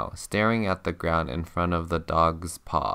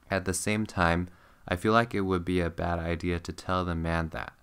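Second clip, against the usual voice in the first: usual speaking voice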